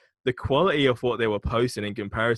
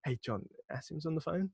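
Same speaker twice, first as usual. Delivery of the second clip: hushed, much softer aside; mock whisper; 5 semitones higher, 10 dB quieter than usual. Gatekeeper quietly alerting the boss to a caller, mock whisper. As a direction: imitate someone whispering off the phone. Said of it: "just whisper to John".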